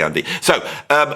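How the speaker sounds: ordinary talk, nothing marked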